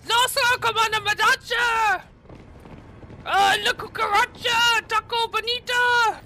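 high, shouted parody of a villager; cartoonish high Spanish hollering; mock Spanish enemy. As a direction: yell mangled Spanish like a game henchman. Parodies Spanish-speaking game enemies.

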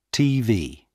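ordinary delivery

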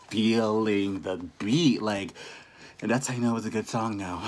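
breathless after dancing; winded from dancing; out of breath. Real, not acted. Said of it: "I'm out of breath".

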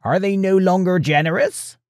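high croaky questioning; beggar's croak. A narrator's reading. Beggar asks about the royal house, high croaky voice. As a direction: ask in a high, creaky beggar voice. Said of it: "croaked the beggar".

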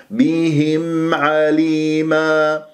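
بهم عليما slowly, the mim held clear on the lips; model recitation. Shown as a demonstration. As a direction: chant بهم عليما slowly with long vowels. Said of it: "بهذه الطريقة". (بهم عليما بتلاوة مجودة متأنية)

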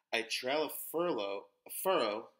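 ordinary delivery, nothing marked

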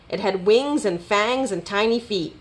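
ordinary delivery, nothing marked

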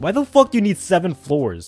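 ordinary delivery

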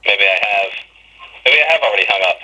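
ordinary talk, nothing marked

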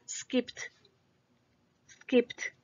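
Skipped in one syllable ending in crisp unvoiced PT; final T sound. Shown as a demonstration. Teacher shows P does not vibrate, T ending. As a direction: finish Skipped on a T, never a D. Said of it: "el sonido de la T".

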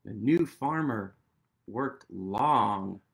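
measured storybook read-aloud; story narration. A narrator's reading. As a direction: read it like the page of a bedtime book. Reads the book's text aloud.

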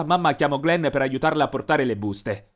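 ordinary delivery, nothing marked

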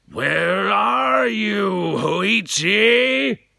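long, loud ghostly samurai wail; eerie ghost call. Acted. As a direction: draw out the call like a haunting. Samurai searching.

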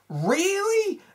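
mock despair at the lazy film; exasperated disbelief. Real, not acted. Reviewer groaning at the dance scenes, high disbelief.

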